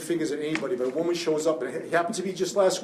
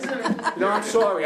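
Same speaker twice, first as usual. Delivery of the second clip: apologetic laugh, pitched higher; embarrassed laugh; 4 semitones higher, slower than usual. Real, not acted. Lawyer apologizing to the class for tearing up, embarrassed laugh. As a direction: laugh it off while choked up.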